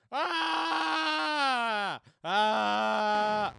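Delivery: loud high screams; full-throated "Ah!" yells; game scream. Pretend. Player screaming to keep his game alive, screamed. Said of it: "you can hear me screaming".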